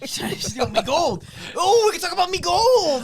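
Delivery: guard your gold like a hammed-up leprechaun; mock leprechaun. Hosts joke about his gold, mock leprechaun building to a shout.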